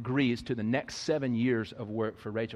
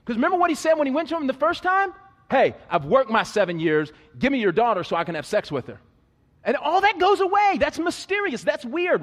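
loud, high, slowing preacher's build-up; preaching at full force; 12 semitones higher, 9 dB louder than usual. Acted. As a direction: drive it home to the congregation, climbing.

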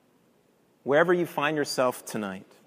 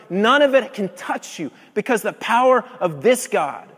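loud, driving sermon delivery; preacher hitting his climax; full-force preaching; 6 semitones higher, 8 dB louder than usual. Acted. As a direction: proclaim it to the whole room.

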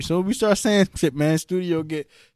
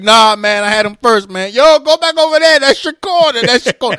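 mock turf argument; 5 semitones higher, 6 dB louder than usual.

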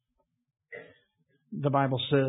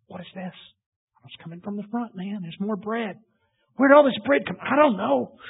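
amazed crowd; 6 semitones higher than usual. Acted.

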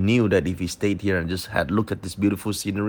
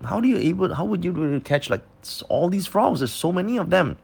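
curious; 8 semitones higher than usual. Retold or imitated. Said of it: "I'm just a bit curious".

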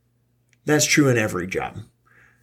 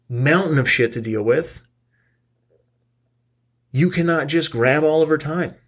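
heated rant; 6 semitones higher than usual. Real, not acted. Ranting at the chem teacher.

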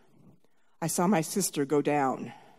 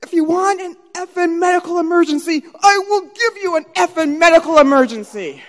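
loud, high-pitched tirade; furious outburst; 10 semitones higher, 8 dB louder than usual. Retold or imitated. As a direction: yell it as an indignant threat. Acted outburst of furious sister.

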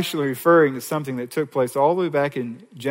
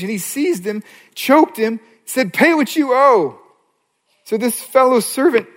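high, loud, driving parable telling; preaching at full force; 8 semitones higher, 6 dB louder than usual. Acted. Preacher retelling the parable to his church, at full force. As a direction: drive the story home to the congregation.

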